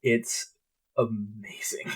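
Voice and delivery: awed hushed whisper. Real, not acted. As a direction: breathe the word in awe. Hushed awe about VR mod.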